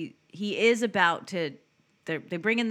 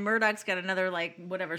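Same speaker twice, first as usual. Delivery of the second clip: ordinary talk, nothing marked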